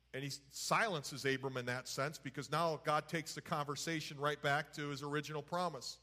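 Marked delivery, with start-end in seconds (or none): none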